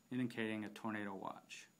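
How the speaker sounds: ordinary talk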